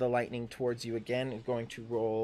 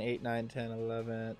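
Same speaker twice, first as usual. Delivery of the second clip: ordinary talk, nothing marked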